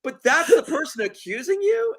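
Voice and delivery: ordinary delivery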